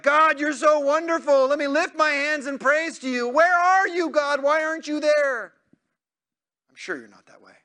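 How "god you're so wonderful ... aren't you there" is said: much higher and louder, praise flipping to desperate pleading; swinging worshipper. Acted. Preacher mocks the congregation's up and down prayers to God, exaggerated. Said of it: "doesn't look like".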